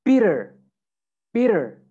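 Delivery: American "bitter", tt as a quick D, R kept; American accent. Shown as a demonstration. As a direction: say "bitter" like "bidder", American style. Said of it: "American accent, you would say".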